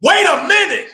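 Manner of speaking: very high, loud shout; imitated different voice. Sister snapping when the bandana is grabbed, imitated furious shout. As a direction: explode into a high, shouted warning. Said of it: "it was a different voice".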